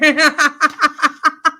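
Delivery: high, loud rolling laugh. Real, not acted. Host amused at scratching on camera, loud laugh. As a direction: burst out laughing at yourself.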